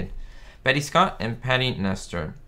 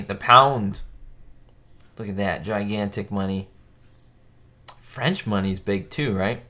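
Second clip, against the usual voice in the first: ordinary talk, nothing marked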